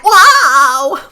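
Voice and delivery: high, loud sarcastic wow; exaggerated fake amazement; hammed-up astonished wow; mock wow.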